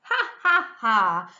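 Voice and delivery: big teasing ha ha ha; mocking laugh. A narrator's reading. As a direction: laugh at your hard-working brother. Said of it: "laughed the first little pig".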